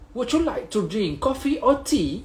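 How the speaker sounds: slow English accent with breathy tea; a lightly put-on English speaker; English accent demo. Shown as a demonstration. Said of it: "dia sebut tea".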